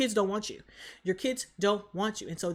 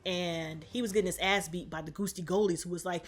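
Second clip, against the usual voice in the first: ordinary delivery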